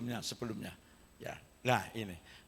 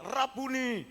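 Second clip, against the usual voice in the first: mild mock cry; 7 semitones higher, slower than usual. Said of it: "Langsung dia teriak".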